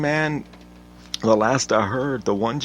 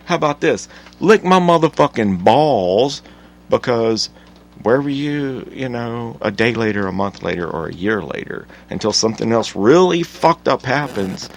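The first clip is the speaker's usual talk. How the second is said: angry rant; 6 dB louder than usual. Acted. Telling off the news people, loud and furious. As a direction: let it rip at them.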